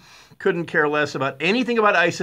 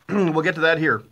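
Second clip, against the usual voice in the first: ordinary talk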